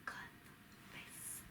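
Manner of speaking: near-silent, slowly mouthed word; whisper. Real, not acted. Giving the friend a word to lip-read, whispering. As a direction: breathe it syllable by syllable.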